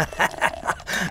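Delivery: quiet breathy snicker; soft snicker. Acted. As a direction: snicker to yourself. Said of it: "en ricanant". (ricanement étouffé)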